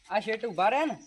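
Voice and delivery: ordinary delivery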